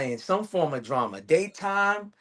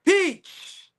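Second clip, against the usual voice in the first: much higher, big announcer reveal; award announcer. Pretend. Teasing his friend with a mock Oscar, announcer reveal. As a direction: announce the winner with a flourish.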